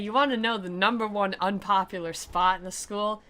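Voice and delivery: usual speaking voice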